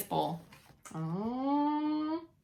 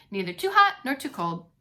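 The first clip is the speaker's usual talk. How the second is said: ordinary delivery, nothing marked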